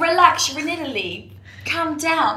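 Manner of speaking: a bad Italian accent doing the laid-back driver; Italian driver telling a nervous tourist to relax, in a bad Italian accent. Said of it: "That's a really bad Italian accent".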